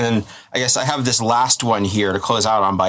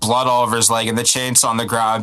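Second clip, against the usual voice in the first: usual speaking voice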